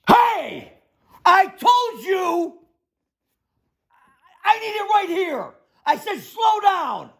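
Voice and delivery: crazy-guy shouting, very high pitched. Acted. Builder pretending to rage at cement driver, yelling. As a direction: yell at the crew to scare onlookers. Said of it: "as soon as I raise my voice like that".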